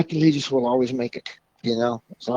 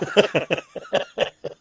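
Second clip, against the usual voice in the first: high burst of laughter; 6 semitones higher than usual. Real, not acted. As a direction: crack up laughing. Punchline of funny story.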